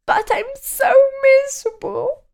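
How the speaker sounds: very high, weepy whine; miserably. A narrator's reading. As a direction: sob it out hopelessly. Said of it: "he added miserably".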